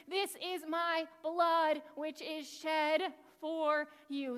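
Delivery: very slow and solemn; solemn quote. Acted. The teacher voices Jesus pouring wine for the disciples, slow and solemn. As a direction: speak each word like a sacred vow.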